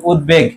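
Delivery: উদ্বেগ as "ud-beg"; teacher demo of উদ্বেগ, split before the b; b kept audible. Shown as a demonstration. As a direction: sound the b clearly in উদ্বেগ. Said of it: "বহাল থাকবে".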